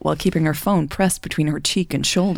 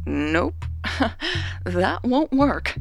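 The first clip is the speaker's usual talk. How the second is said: higher, slow, amused refusal with a chuckle; chuckling; 7 semitones higher, 5 dB quieter, much slower than usual. A narrator's reading. Sister sees through Raven's dodge, chuckling. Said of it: "Jasmine chuckled".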